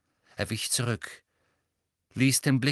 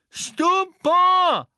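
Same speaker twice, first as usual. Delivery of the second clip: much higher, very loud spell cry; shouted spell; 10 dB louder than usual. A narrator's reading. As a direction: fire the spell with a full-throated yell. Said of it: "rief Neville erneut". (laut geschrienener Zauberspruch, viel höher)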